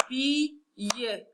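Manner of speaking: Billet broken as bi, pause, ille; split into syllables. Shown as a demonstration. Teacher counting syllables for pupils, Billet split in two. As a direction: sound out Billet in two separate parts. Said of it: "On va compter ensemble les syllabes".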